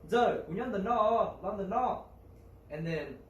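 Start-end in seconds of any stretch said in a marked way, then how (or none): 0.1-2.0 loud, fast call across the forest. Acted. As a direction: call up to her from the cave, loud and quick. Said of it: "and he yells out".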